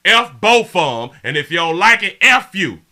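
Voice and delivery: loud, high defiant rant at the chat; angry outburst. Real, not acted. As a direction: spit it out, daring them to object. Streamer ranting at chat.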